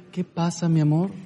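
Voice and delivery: disarm his anger with tenderness; sweet wife. Retold or imitated. Wife answers angry husband sweetly.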